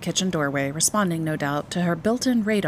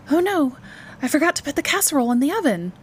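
high, fast, flustered exclamation; sudden alarm; 10 semitones higher, 4 dB louder, faster than usual. A narrator's reading. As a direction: blurt it out in dismay. Mother suddenly remembers the dinner.

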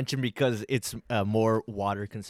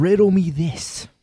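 Riddler line; 6 semitones higher, 8 dB louder, slower than usual. Retold or imitated. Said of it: "but he said, like".

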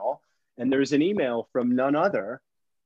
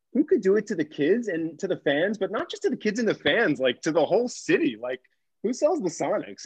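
higher, animated appeal; incredulous outrage; 6 semitones higher than usual. Retold or imitated. Young guest pressing the CEO about the Sonics, incredulous. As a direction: swoop up in disbelief at the injustice. Pleads with Schultz over Sonics sale.

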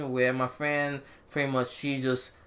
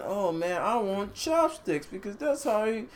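mock whiny weeb; 7 semitones higher than usual.

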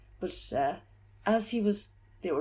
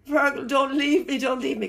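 raised, pleading cry of a grieving friend; retold desperate plea; 5 semitones higher, 9 dB louder than usual. Grieving friend at the deathbed, begging Fergal to stay, loud plea. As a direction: beg him not to go, voice lifted high and loud. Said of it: "Kept saying".

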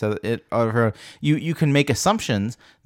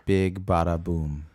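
slow, quieter movie catchphrase; quoting catchphrase; 8 semitones lower, 6 dB quieter, slower than usual. Retold or imitated. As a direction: drawl it like the film. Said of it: "don't use catchphrase".

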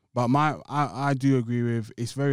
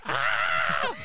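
mock crying wail; 3 semitones lower than usual. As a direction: wail like you're copying a crying partner. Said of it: "You cry".

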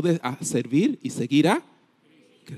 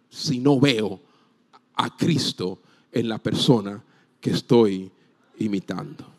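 ordinary talk

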